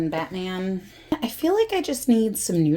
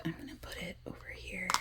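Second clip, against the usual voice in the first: whispering; 19 dB quieter, faster than usual. Real, not acted. Quick whispered aside while arranging.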